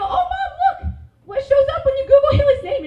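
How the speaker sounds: high, eager tattle to mom; gleefully snitch on your big sister; tattling little sister. Retold or imitated.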